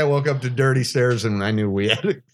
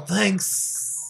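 weak thanks; 6 dB quieter than usual. Retold or imitated. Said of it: "He's all".

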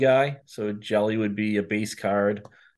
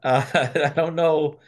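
laughing; 7 semitones higher, 4 dB louder, slower than usual. Real, not acted. Laughs at the mountain biking question.

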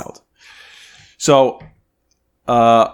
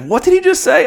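high, loud disbelief; buddy Kevin in the bathroom, high and shocked; stunned buddy; 6 dB louder than usual. Retold or imitated. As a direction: Kevin can't believe what he heard. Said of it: "He's like".

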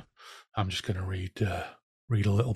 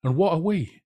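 high-pitched, challenging cry; shrill cry; 6 semitones higher than usual. A narrator's reading. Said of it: "cried shrilly".

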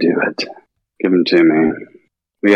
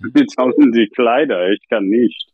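hammed-up German, a bit higher; mock German; 4 semitones higher than usual. Prankster leaving a fake German message, mild mock German. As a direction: play a bumbling German caller.

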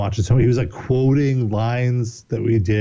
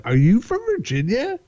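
much higher, familiar casual "Are you from Virginia?"; as if he knew me; 12 semitones higher than usual. Retold or imitated. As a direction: ask like greeting an old friend. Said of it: "like he knew me".

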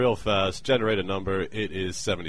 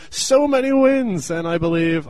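boastful gloat; 11 semitones higher, 8 dB louder than usual. Acted. Boastful character voice.

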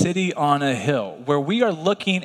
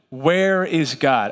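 slow weighty question; much slower than usual. Shown as a demonstration. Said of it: "we ask this question".

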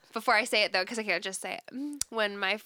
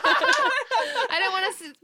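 laughing; 13 semitones higher, 5 dB louder, slower than usual. Real, not acted. Laughing while explaining.

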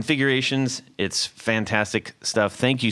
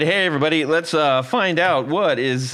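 bright announcer intro, raised pitch; radio host voice; 7 semitones higher, 4 dB louder than usual. Acted. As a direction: kick off the segment like a DJ. Host launches sponsor segment.

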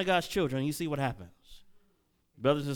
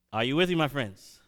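ordinary delivery

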